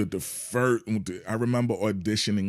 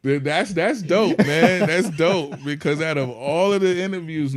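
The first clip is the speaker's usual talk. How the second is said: high, loud, delighted surprise; thrilled; 8 semitones higher, 8 dB louder than usual. Real, not acted. Guest to interviewer who asked something new, thrilled. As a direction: burst out with real appreciation.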